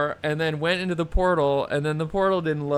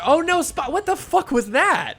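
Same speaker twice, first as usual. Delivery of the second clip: startled shout, much higher and louder; shocked yell; 13 semitones higher, 6 dB louder than usual. Real, not acted. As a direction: yell in real alarm as the game glitches. Shocked yell at the game glitch.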